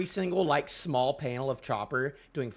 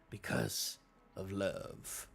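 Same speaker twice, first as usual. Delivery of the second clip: dramatic hush; 8 semitones lower, 10 dB quieter, much slower than usual. Acted. Dramatic reading of line.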